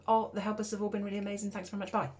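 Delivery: fast, high, blurted thanks; rushed nervous thanks. Retold or imitated. Frozen speaker rushing a thank-you into the microphone, fast and high. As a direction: gabble it out to get off the mic. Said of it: "I just then said something really rubbish".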